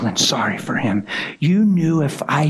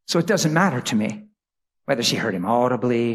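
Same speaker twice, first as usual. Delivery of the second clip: normal talking voice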